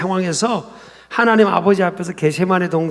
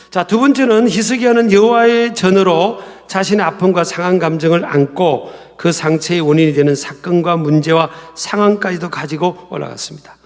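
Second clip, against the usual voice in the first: normal talking voice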